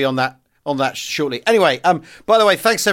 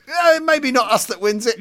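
put-on Italian accent; 9 semitones higher than usual. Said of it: "He's going".

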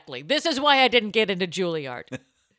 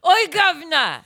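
high, loud, rowdy Cockney shout; Cockney rock star; 8 semitones higher, 5 dB louder than usual. Pretend. Singer turned Rolling Stones, shouting Cockney at the director. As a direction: yell it like a drunk British rocker. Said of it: "Now you're the Rolling Stones".

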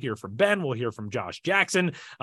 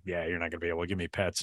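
dog voice; 8 semitones lower, 10 dB quieter, faster than usual. Retold or imitated. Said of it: "she was like".

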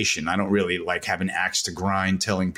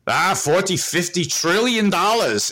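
put-on Swiss German accent; 10 semitones higher than usual. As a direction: mimic a Swiss German guest naming a huge number. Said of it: "in his Swiss German accent".

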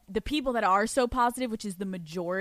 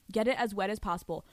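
normal talking voice

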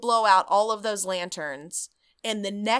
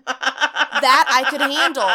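said slow through laughter, louder; laughing; 4 semitones higher, 8 dB louder, slower than usual. Real, not acted. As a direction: laugh the words out. Laughing at the Chili's joke.

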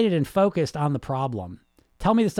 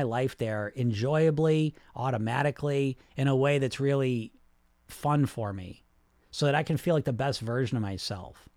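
softer, lower, supportive self-talk; encouraging inner voice; 3 semitones lower, 3 dB quieter than usual. Acted. Coach voicing kinder questions to yourself, encouraging and supportive. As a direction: gently coax yourself, low and unhurried. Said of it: "an encouraging, supportive voice in your head".

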